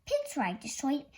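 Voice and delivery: usual speaking voice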